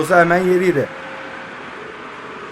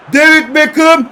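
commentator call; 7 semitones higher, 5 dB louder, slower than usual. Acted. Calling the player like a commentator.

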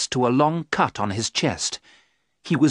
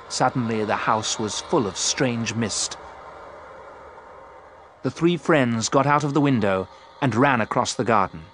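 usual speaking voice

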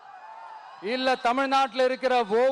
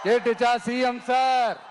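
taunting shout. Real, not acted. Leader taunting the chief minister with the crowd's roar.

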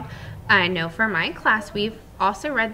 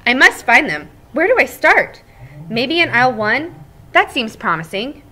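lively read-aloud voice for the class; storybook narration; 7 semitones higher, 14 dB louder than usual. Acted. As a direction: tell it like a cheerful egg to children.

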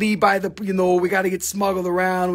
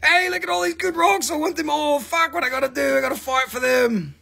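much higher, eager greedy buyer voice; excited buyers; 7 semitones higher than usual. Retold or imitated. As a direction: play the rock-hungry crowd grabbing at everything. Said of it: "everybody's like".

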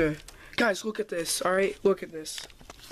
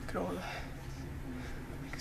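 whisper; 15 dB quieter, much slower than usual. Real, not acted. Hushed view outside.

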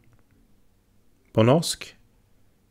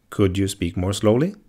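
ordinary delivery

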